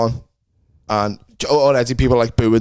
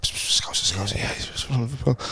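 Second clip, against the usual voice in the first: hushed crowd whisper; conspiratorial whisper; 8 dB quieter than usual. Retold or imitated. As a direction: whisper it like gossip across the room. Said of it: "you can see they're going".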